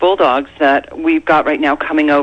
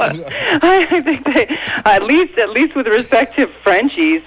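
laughing; 8 semitones higher than usual. Real, not acted. Laughing after the joke.

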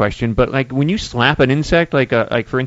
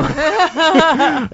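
big laugh; 14 semitones higher than usual. Real, not acted. Laughs at windshield joke.

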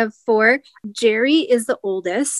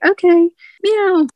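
cat voice; 8 semitones higher, 4 dB louder, slower than usual. Pretend. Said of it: "And she was like".

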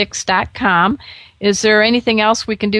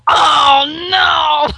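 1 mock crowd dismay; 8 semitones higher than usual. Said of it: "you hear everybody go".

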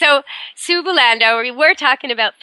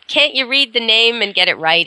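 ordinary talk, nothing marked

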